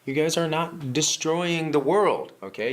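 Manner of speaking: ordinary delivery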